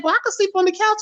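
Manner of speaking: high-pitched mother offering, voice lifted way up; imitating his mother. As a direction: do mom's eager offer up high.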